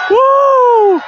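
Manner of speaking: very high, loud whoop; thrilled high yelp; excited whoop. Real, not acted. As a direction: whoop with joy. Balloon rising.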